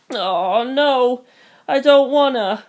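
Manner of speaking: high, wailing refusal; acted childish meltdown; toddler tantrum. A narrator's reading. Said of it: "like a toddler throwing a tantrum".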